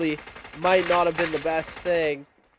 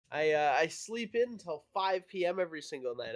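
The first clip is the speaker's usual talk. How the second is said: ordinary talk, nothing marked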